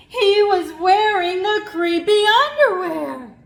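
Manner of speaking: high, loud gasp of a reveal; shocked reveal. A narrator's reading. Narrator revealing the underwear came back, shocked. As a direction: announce it like a horror twist.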